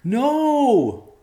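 high, loud drawn-out cry; horrified yell. A narrator's reading. Boy at the bedroom door sees the killing, yelling. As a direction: yell it out at the top of the voice. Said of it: "a yell came at the bedroom door".

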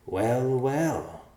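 high, drawn-out "well, well" with a grin; grinning. A narrator's reading. Morduk learns they share a destination, grinning. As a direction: savour it like a delighted rogue. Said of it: "Morduk said with a grin".

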